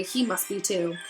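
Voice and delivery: ordinary delivery, nothing marked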